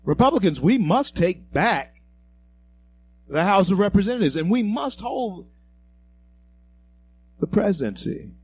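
rallying cry. Real, not acted. Host to Republican listeners, rallying them loudly. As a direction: rouse the party faithful.

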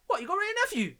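loud, high, excited call for the rum; hyped shout across the bar; hyped-up bar order. Retold or imitated. Mate at the bar, shouting for Ray and Nephew excitedly.

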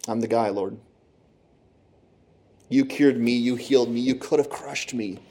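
soft, hushed offering of himself; Isaiah answering the Lord, softly and humbly; humble devotion. Acted.

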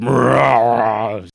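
loud rolling monster growl; guttural undead roar; zombie growl. Acted. Game master imitates approaching zombie sounds.

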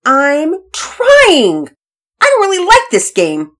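loud, high-pitched wail of protest; upset little girl defending herself; defensive. Acted. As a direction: snap back that it's not your fault. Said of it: "Penelope responded defensively".

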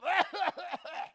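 drawn-out mock hacking; comic coughing fit; fake coughing fit. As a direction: cough like you just caught it. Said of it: "guy over here is like".

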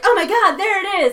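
high, loud panicked "there it is"; mock alarm at spotting the monster; mock alarmed cry. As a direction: shout it like a startled auror. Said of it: "and they're like".

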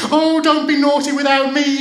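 put-on very high, loud childish wail; crying out. A narrator's reading. Child begging sister not to leave her out, wailing. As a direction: wail in protest at top pitch. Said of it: "she cried".

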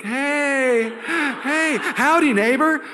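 high, loud mock-friendly neighbor greeting; cheery neighbor. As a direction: ham up an overly sunny suburban neighbor.

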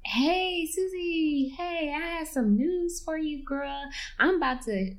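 chirpy announcement, unaware of the bomb; breezy mom. Retold or imitated. Mother cheerfully telling Susie her news, lightly mocked.

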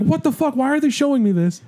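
high-pitched, mock-appalled outburst; retold alarmed protest. Recalling a kid shown a scary trailer, high alarmed protest.

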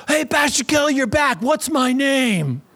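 put-on high-pitched excited kid calling out. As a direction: imitate kids mobbing their camp pastor. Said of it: "Kids would run up to me".

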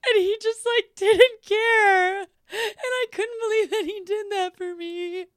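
high, teary mock sob in her voice; a girl crying over her love story; imitating her crying. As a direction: quote her weeping, voice high and cracking. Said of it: "she starts full on crying".

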